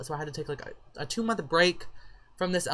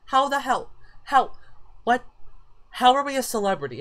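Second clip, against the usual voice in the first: high, loud, astonished questioning; bewildered disbelief; 8 semitones higher, 5 dB louder than usual. Real, not acted. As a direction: demand an explanation in sheer disbelief. Baffled by sudden celebrity status.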